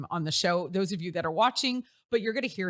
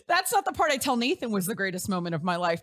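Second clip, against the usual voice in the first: ordinary talk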